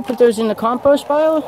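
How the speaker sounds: high-pitched, sing-song question to the hens; talking to pets. Real, not acted. Asking his hens about the compost pile, in high pet talk. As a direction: coo it at the chickens.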